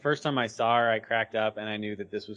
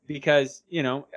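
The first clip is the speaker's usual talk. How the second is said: ordinary talk, nothing marked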